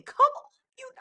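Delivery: very high come on; exasperated. Real, not acted. Reactor to the storyteller, very high exasperated. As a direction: squeal it in disbelief.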